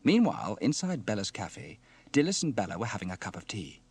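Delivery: ordinary delivery, nothing marked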